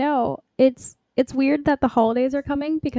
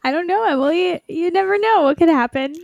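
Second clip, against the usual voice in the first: high teasing suspense voice; mock ominous suspense; 8 semitones higher, 4 dB louder than usual. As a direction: tease it like a cliffhanger.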